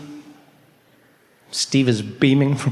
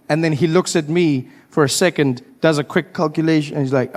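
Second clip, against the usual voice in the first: usual speaking voice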